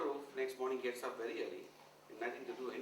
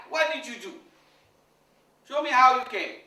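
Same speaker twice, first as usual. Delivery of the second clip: loud demand; 8 semitones higher, 16 dB louder than usual. Retold or imitated. Guru demanding.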